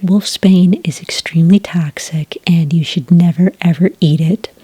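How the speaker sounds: ordinary talk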